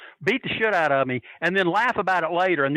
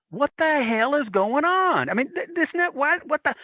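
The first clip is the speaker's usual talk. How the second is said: bewildered onlookers; 10 semitones higher than usual. Retold or imitated. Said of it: "they're standing there looking, going".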